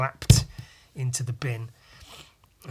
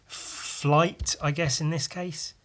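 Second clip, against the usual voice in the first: ordinary talk, nothing marked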